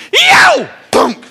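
high loud yelp, then a thunk; mock cry and impact noise; yelp then thud. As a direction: imitate the yelp and the thud of a clothesline. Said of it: "that was the literal sound".

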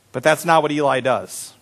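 usual speaking voice

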